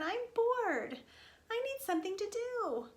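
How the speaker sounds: bored little mouse. Acted. Country mouse tells his parents he has nothing to do, high-pitched complaint.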